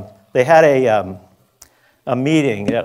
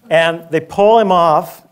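ordinary talk, nothing marked

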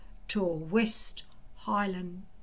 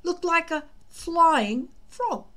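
put-on higher, eager boyish voice, building loud; a keen young Viking talking up a wonder dragon; Fishlegs' excited voice; 4 semitones higher, 5 dB louder than usual. Fishlegs urging Hiccup about his destiny, eager higher boyish voice.

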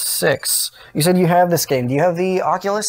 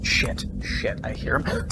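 usual speaking voice

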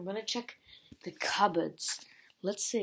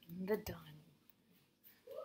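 whispering; 4 semitones lower, 12 dB quieter than usual. Real, not acted.

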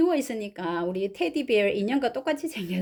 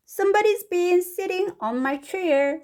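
high-pitched Mr. Blair, slow and astonished; slow, raised character voice; indignant story father; 8 semitones higher, much slower than usual. Acted. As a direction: read as a puzzled storybook dad.

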